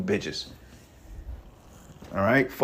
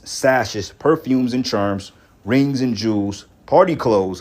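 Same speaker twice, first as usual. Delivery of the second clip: scripture reading; 4 dB louder than usual. Acted. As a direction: declaim each item in the list like a sermon. Reads scripture aloud.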